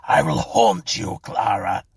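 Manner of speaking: loud, forceful threat; menacing curse. A narrator's reading. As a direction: curse her with all your force. Dying David threatens his wife.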